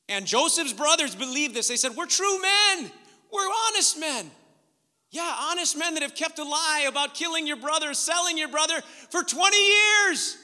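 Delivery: high, loud, building to a shout; indignant pulpit shouting at hypocrisy; preaching at full force. Real, not acted.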